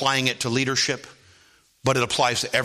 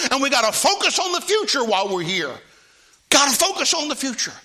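full-force preaching; 10 semitones higher, 4 dB louder than usual. Acted. Preacher pressing the church on strangers and the flesh, at full force. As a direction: hammer each phrase out.

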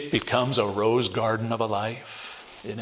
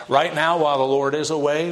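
ordinary delivery

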